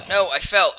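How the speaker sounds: sudden high, loud cry of dismay; startled yelp. Real, not acted. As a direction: yelp it as you slip and fall. Falls off the house mid-build.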